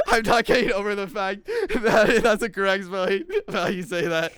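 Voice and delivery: loud, high helpless laughter talk; laughing fit. Real, not acted. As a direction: push the words out through laughter. Laughing fit over the word.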